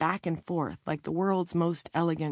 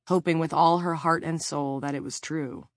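ordinary delivery

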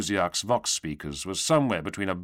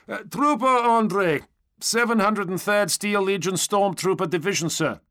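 high, loud trooper voice; stormtrooper giving his rank; 11 semitones higher, 4 dB louder than usual. A narrator's reading. Stormtrooper answering the knight's question, high dutiful voice. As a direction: announce name and unit, pitched high.

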